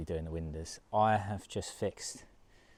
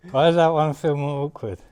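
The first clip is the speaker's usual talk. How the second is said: loud, strained under weight; straining; 8 semitones higher, 15 dB louder than usual. Real, not acted. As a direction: push it out while lifting.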